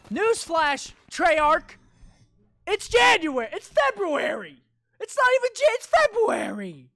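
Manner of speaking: high, loud, slow sarcastic callout; mocking rant. Real, not acted. Gamer mocking Treyarch over a Christmas ornament, high and loud. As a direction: scold the developer with mock outrage. Ranting at the developer.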